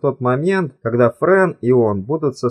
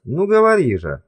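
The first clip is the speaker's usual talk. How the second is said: higher-pitched, lightly urging narration; encouraging; 7 semitones higher than usual. A narrator's reading. Man coaxing his hesitant companion to speak, encouraging. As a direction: gently prod the listener to go on. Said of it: "подбадривал её Сэм".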